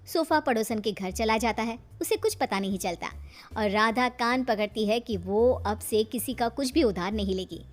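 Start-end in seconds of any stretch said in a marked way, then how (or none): none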